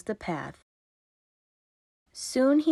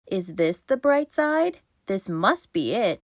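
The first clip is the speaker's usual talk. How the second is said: usual speaking voice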